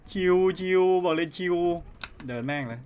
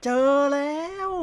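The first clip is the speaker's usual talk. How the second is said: excited shout; 10 semitones higher, 5 dB louder than usual. Real, not acted. Found the item.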